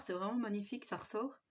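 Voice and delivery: ordinary talk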